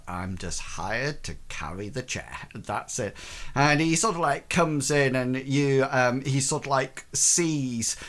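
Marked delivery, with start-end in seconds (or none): 0.1-3.2 low, quiet inner grumble; footman thinking to himself. Acted. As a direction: drop low and soft, as if to yourself. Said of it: "he's probably thinking to himself".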